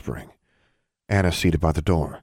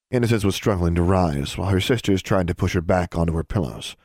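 usual speaking voice

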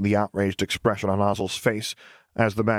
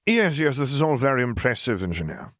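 oily voice; 5 semitones higher than usual. Acted. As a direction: purr it out oily and condescending. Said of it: "an oily voice sounded".

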